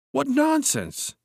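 very high, stretched-out protest; light dismissal. A narrator's reading. Cousin brushing off narrator's fury, dismissive. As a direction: wave it away in a high voice.

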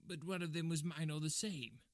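high-pitched whiny little man; whining. Acted. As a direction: whine a feeble protest. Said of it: "whined the little man".

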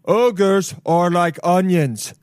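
slow, drawn-out ogre impression; hammed-up Shrek, every word stretched.

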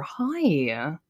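soft, drawn-out nice hi. Shown as a demonstration. Host demonstrating nice greeting voices, soft and nice. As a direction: put on your nicest voice, gently. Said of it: "some very nice voices".

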